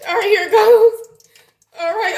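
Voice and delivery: high and loud psych-up; nerving herself up; loud high here-goes; bracing cry. Real, not acted. Bracing to drop chicken in.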